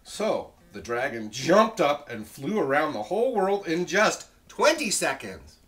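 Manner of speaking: loud, animated storybook reading; story narration. A narrator's reading. Teacher reads the dragon's flight to children, loud and animated. Story read at full volume.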